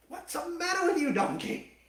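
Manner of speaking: high, loud mock angry yell; hammed-up furious rider; ticked off. Balaam yelling at his stubborn donkey, mock angry and high. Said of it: "Ticked off".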